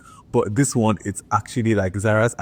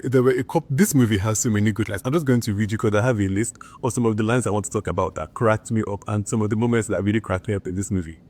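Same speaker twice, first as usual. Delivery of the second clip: usual speaking voice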